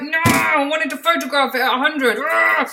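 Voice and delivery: high, loud whine of disappointment; whiny disappointment. Retold or imitated. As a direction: complain like a thwarted child. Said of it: "And I was like".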